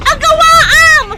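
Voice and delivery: loud battle call; shouted command. Acted. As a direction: bark it across the fight. Fight scene, calling out.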